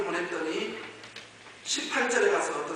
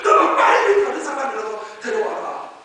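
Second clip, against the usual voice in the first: loud, raised-voice order; heated, urgent shout; angry command; 8 semitones higher, 13 dB louder than usual. Acted. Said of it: "하나님이 막 화를 내시면서".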